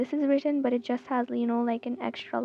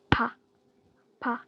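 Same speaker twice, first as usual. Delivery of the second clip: usual speaking voice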